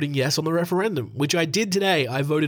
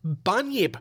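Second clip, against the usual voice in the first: higher, hammed-up Australian accent crying out in mock panic; broad Australian alarm; 5 semitones higher, slower than usual. Host pretends to spot a bunyip in a broad Australian accent. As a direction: caricature Aussie spotting a bunyip, mock alarm. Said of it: "broad caricaturesque Australian accent at the beginning".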